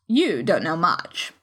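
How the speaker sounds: put-on low, slow, scornful old lady; rude duchess. A narrator's reading. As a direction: sneer it down at her.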